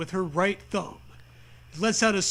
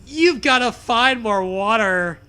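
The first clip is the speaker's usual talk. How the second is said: mock shout; 3 semitones higher, 7 dB louder than usual. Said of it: "you shout".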